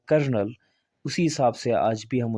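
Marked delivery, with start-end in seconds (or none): 0.1-0.5 "कर्नल" as kur-nul, two syllables; correct pronunciation. Shown as a demonstration. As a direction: silent first L in "कर्नल", said as r. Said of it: "French में पढ़ा जाता था". ("कर्नल", दो हिस्सों में सही उच्चारण)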